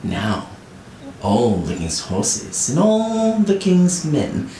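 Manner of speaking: usual speaking voice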